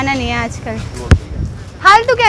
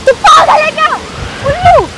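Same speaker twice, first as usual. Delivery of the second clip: much higher, furious scolding; outraged; 8 semitones higher than usual. Real, not acted. She was shooting a video and he broke her phone, outraged. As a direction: tear into him, voice pitched way up.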